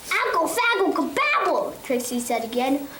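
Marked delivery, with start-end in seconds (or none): 0.1-1.7 put-on high, urgent baby babble; babbling toddler. Trixie babbles urgently at her daddy, high baby voice. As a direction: squeal nonsense words like a toddler.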